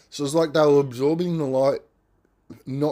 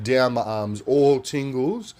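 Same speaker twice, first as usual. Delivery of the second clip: ordinary talk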